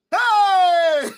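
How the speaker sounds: high loud laughing yelp; laughing shout. Real, not acted. Vlogger cracking up at his own funeral joke, loud laughing yelp. Mock wail bursting into laughter.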